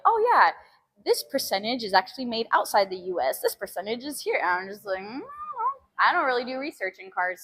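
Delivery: ordinary delivery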